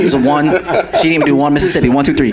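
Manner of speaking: laughing through the words; chuckling while retelling. Real, not acted. Laughing as he recalls mom's short count.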